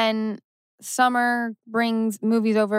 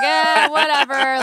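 louder, higher, drawn-out careless shrug; phoning it in; 5 semitones higher, 5 dB louder, slower than usual. Retold or imitated. Imitating an actor having fun with his storyline, exaggeratedly careless. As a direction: stretch it out slowly in a mock couldn't-care-less voice. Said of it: "phoning it in".

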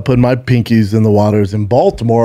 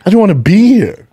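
mock whine; 7 semitones higher, 3 dB louder than usual. Mock victim whining.

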